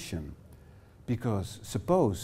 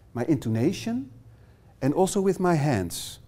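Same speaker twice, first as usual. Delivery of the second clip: normal talking voice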